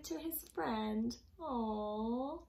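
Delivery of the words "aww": sympathetic aww. A narrator's reading. Flower picked, sympathy for the plant.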